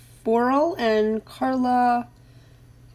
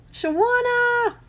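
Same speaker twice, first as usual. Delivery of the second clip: excited greeting; 12 semitones higher, slower than usual. Real, not acted. Greeting a viewer on live stream.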